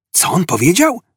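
high-pitched, puzzled query; astonished question. A narrator's reading. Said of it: "wyszeptał Ford zdziwiony". (zdziwione pytanie, wysokim głosem)

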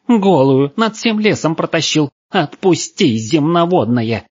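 put-on high-pitched outraged girl; hysterical, high voice of a furious captive; hysterical yelling. A narrator's reading. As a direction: yell to be let go. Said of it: "истерично вопила девушка".

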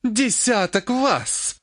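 high, very slow outraged echo; incredulous, seething repetition of the offer; angry exhalation. A narrator's reading. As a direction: breathe it out angrily, stretching each word. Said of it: "наконец зло выдохнула она".

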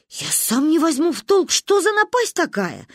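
high, baffled self-excuse; guilty bewilderment. A narrator's reading. Uncle Misha excusing himself over the car, guilty and baffled. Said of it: "виновато разводил руками".

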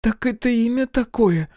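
very high astonished question; amazed. A narrator's reading. Olli on learning the odd name, amazed. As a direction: ask it amazed, high-voiced. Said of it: "изумилась Олли".

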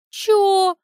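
bewildered what?; a woman stunned by a strange compliment; baffled. Acted.

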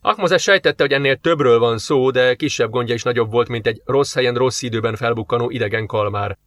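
ordinary delivery, nothing marked

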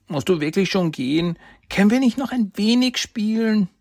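put-on high, whiny child begging; a little boy who doesn't want him to go; young boy pleading.

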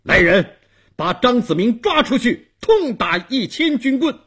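loud, high-pitched furious command; stern shout. A narrator's reading. Dong Wang orders guards to seize Zhang Ziming, shouting sternly. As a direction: shout the order with harsh authority. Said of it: "东王厉声喊".

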